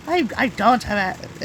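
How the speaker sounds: ordinary talk, nothing marked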